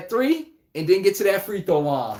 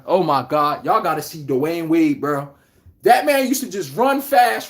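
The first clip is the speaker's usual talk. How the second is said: ordinary talk, nothing marked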